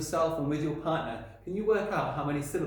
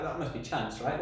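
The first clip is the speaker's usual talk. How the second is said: ordinary delivery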